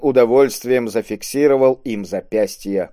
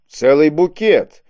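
slow, raised, louder mock admiration; drawn-out, higher note of admiration; near-admiring exclamation; 6 semitones higher, 5 dB louder, slower than usual. A narrator's reading. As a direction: exclaim as if almost impressed. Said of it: "едва ли не с восхищением воскликнул".

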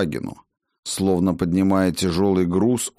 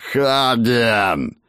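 inhuman roar; 3 semitones higher than usual. Acted. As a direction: roar his name like a beast. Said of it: "Он взревел нечеловеческим голосом".

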